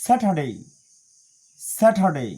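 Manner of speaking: "স্যাটারডে" as SA-tuh-day, R silent; loud "স্যাটারডে" with aspirated T and no R; British pronunciation. Shown as a demonstration. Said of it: "British standard pronunciation অনুযায়ী শিখব".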